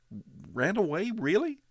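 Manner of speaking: put-on high, startled young boy; boy hearing the news in disbelief; shocked boy. A narrator's reading. Boy surprised.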